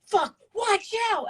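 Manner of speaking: high, drawn-out yell at the game; imitated gamer screaming. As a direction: yell like a startled gamer mid-match. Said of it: "Max screaming".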